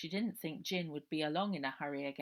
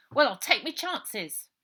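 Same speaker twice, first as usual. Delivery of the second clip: higher, louder cockney lad, defiant; street boy Robert; 8 semitones higher, 14 dB louder than usual. Acted. Robert brushing off Ida's warning, defiant cockney boy. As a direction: toss it back cheekily.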